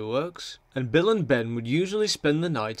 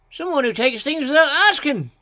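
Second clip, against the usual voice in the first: high, emphatic engine character; high-voiced little engine; 12 semitones higher, 5 dB louder than usual. A narrator's reading. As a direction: explain it in a high, perky character voice. Engine Bill explains in character.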